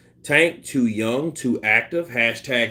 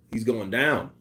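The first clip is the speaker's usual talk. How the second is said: normal talking voice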